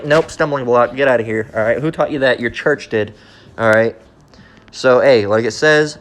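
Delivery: normal talking voice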